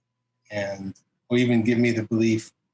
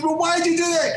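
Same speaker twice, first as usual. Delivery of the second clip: baffled. Retold or imitated. Neil baffled, retold.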